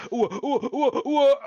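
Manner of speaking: high, loud laughing panic; panicked whoa. Retold or imitated. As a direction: yelp it like he's about to fall. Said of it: "He's like".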